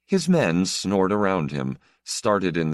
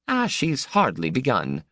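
midwife's scorn, pitched higher; contemptuous midwife; 9 semitones higher than usual. Acted. Midwife to the anxious Count, scornful and high. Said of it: "the midwife said with contempt".

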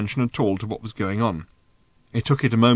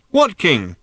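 raised, loud puzzled call; shouted question; 6 semitones higher, 6 dB louder than usual. A narrator's reading. Rider asks over the hooves, shouting. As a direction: yell back in confusion.

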